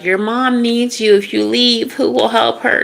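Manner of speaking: high-pitched pleading guilt trip; mock guilt trip. Relative guilting someone about mom, high mock pleading. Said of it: "it's giving me that energy of".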